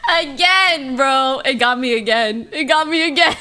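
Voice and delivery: loud, amused protest breaking into laughter; loud and laughing at being caught out; laughing exclamation. Real, not acted. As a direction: cry it out, then crack up.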